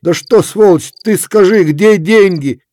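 high, loud demanding; angry demand. A narrator's reading. Efrem demands money from Kuzma, loud angry. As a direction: demand it hard.

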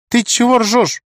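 high indignant snap. A narrator's reading. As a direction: call him out, offended. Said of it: "сразу возмутилась Света".

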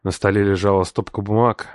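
plain reading voice

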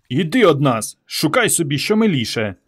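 ordinary delivery

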